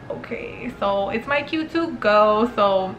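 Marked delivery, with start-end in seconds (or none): 0.1-0.7 muttered okay. Retold or imitated. Awkward resigned okay.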